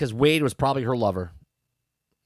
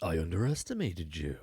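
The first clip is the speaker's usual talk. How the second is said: mock Vader line; 9 semitones lower, 9 dB quieter than usual. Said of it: "And then all of a sudden he says".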